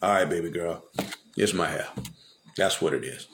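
low, soft, worn-down giving in; Samson giving in. Retold or imitated. Samson yielding to Delilah's nagging, low and soft. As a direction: Samson caving to his lover, sighing it out low. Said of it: "And Sam said".

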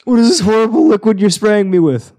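loud, high, outraged cry; indignant outburst. Acted. Ernest shouting at the creature spraying him, loud and outraged. Acted quarrel with rum monster.

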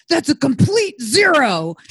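loud, slow mimicked yell; grandfather's yell. Granddaughter imitating her grandfather berating grandmother, yelled. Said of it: "he'd yell".

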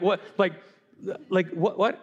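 ordinary talk, nothing marked

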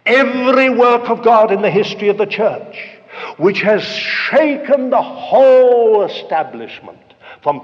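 loud, rousing sermon voice; full-force preaching. Real, not acted. Preacher on works of God that shook the church, forceful preaching. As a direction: hammer it out to the whole congregation.

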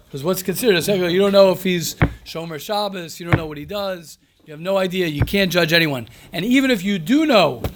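ordinary talk, nothing marked

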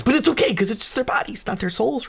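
very high-pitched, squeaky reassurance; anxious justification. Acted. Fads excuses the bodies on cars, anxious high plea.

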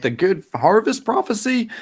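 very high villager voice; squeaky hammed-up bystander; third villager's guess. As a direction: pipe up hopefully from the crowd. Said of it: "the other one's like".

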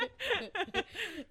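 a high, bursting laugh; hearty laugh. Real, not acted. Laughing at own case.

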